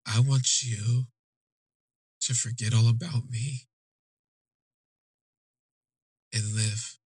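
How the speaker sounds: slow, deliberate retold farewell; measured, halting goodbye; slow farewell plea. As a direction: let the words out slowly, with long pauses. Gentle farewell, he tells her to go.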